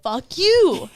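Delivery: hammed-up indignation; mock defiance. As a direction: let the body curse the partner, jokingly. Voicing her body's resentment.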